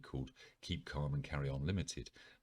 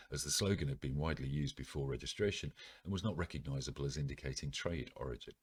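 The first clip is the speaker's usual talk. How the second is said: ordinary delivery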